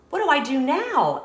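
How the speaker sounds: put-on a curious kid keen for what comes next; eager Pete. Pete asking the librarian what to do, eager.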